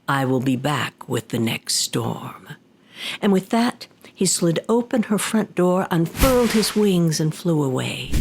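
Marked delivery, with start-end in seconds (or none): 0.1-2.5 low demon voice, politely grand; a deep-set demon taking his leave; Tengu demon voice. Acted. Demon thanking the old woman, low and grand. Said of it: "that Tengu rose to his feet and said".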